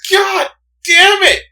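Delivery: high, loud, drawn-out cry of frustration; exasperated shout. Real, not acted. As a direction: scream it out, fed up. Exasperated over repeated argument.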